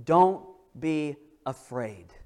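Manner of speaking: slow, soft hey, don't be afraid; tender reassurance. Retold or imitated. God to his people, tender slow reassurance. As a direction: soothe them gently. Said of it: "God to say to his people".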